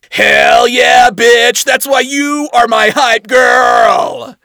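high, loud victorious whoop; triumphant shout. Acted. Adam cheering.

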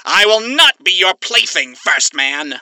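defiant. Acted. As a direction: spit the refusal with pride. Alistair defiant.

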